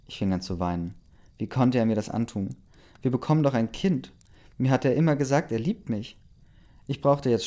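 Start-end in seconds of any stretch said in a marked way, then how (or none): none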